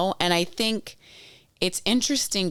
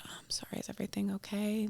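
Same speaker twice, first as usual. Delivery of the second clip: very soft, half-whispered question; hushed concern; 5 semitones higher, 12 dB quieter, faster than usual. Retold or imitated. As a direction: imitate mom gently checking on a stranger. Mom gently asks crying stranger.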